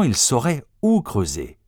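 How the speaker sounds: plain reading voice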